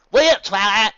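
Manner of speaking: loud southern call; Southern farm pony calling after her; southern drawl. A narrator's reading. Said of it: "called off after ... southern drawl".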